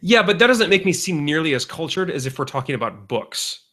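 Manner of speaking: ordinary talk, nothing marked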